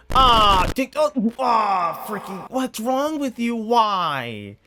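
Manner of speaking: high, loud disgusted outburst; grossed out. Real, not acted. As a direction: recoil in disgust at the screen. Disgusted reaction to licking.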